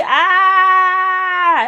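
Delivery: high, loud comic "ah"; hammed-up alarmed cry; mock panic scream. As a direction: let out a long pretend scream of panic. Acting out panic at reality.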